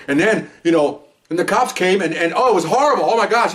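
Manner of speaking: high-pitched, agitated imitation; worked-up retelling. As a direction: act her getting frantic as she relives it. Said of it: "she was screaming".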